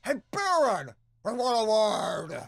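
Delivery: loud, high, drawn-out holler; warthog yell. Acted. Sparrowson hailing the Baron across the foyer, warthog yell. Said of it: "was yelling like a warthog".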